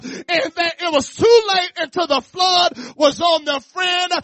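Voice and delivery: loud, rhythmic preacher's call building; preaching at full force. Acted. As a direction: whoop it up to the congregation.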